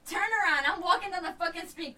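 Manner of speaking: ordinary talk, nothing marked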